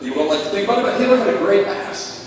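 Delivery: ordinary talk, nothing marked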